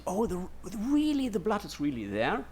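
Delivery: a high, mock gasp of wonder; lightly voiced astonished tourist; amazed visitor. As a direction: play the awed pilgrim lightly. Imagined visitors seeing the relic.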